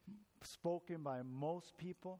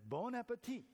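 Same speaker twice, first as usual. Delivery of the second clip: Bon appetit said higher with a clumsy French try; an American proudly attempting Bon appetit; 6 semitones higher than usual. American toasts the Frenchman, trying out French. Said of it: "American man held up his glass and said".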